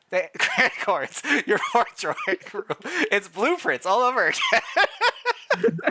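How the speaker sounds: slow, giggling through the words; can't stop laughing at the list; breaking up mid-sentence. Real, not acted.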